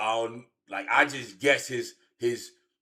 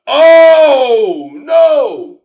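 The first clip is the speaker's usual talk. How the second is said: much higher, much louder mock outcry; shocked shout; 23 dB louder than usual. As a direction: yell it out in disbelief. Shocked reaction in story.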